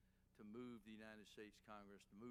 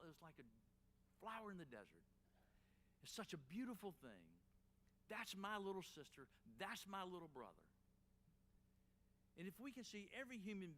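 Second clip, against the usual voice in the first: higher, louder, rising fervor like a sermon; preaching at full force; 6 semitones higher, 4 dB louder than usual. Acted. Closing his speech to the crowd, preaching with rising fervor. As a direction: lift the room, preach it home.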